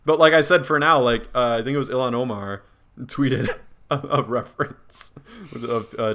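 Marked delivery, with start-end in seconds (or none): none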